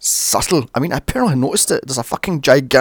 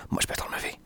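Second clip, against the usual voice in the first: very soft, voiceless whisper; whispered aside; 14 dB quieter, much faster than usual. Real, not acted. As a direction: slip it in under your breath. Muttered aside about another film.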